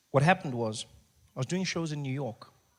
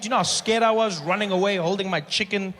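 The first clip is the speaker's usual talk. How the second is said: ordinary talk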